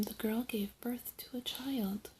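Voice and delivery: plain reading voice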